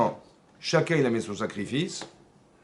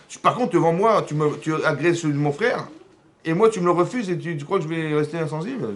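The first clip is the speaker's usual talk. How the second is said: raised, higher voice of wounded outrage; indignant protest; 4 semitones higher, 5 dB louder than usual. Acted. Cain complains to God about the refused offering, indignant. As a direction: protest loudly, stumbling over the accusation. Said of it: "pourquoi tu t'énerves".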